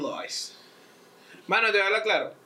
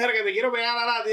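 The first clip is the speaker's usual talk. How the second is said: higher, louder crazy thug voice; mock thug; 7 semitones higher, 4 dB louder than usual. The crazy guy threatens the narrator, mock tough. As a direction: bark it like a street tough. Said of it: "el loco vino y me dijo".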